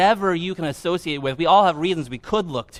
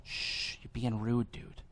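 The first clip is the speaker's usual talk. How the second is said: very soft, low, discreet reproach; quietly tip off a friend, barely audible; mock polite hush; 6 semitones lower, 14 dB quieter, slower than usual. Imagined bystander gently hushing Bart, hushed and low. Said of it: "didn't walk up to him and be like".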